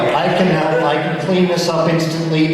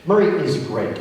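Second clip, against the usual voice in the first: ordinary talk, nothing marked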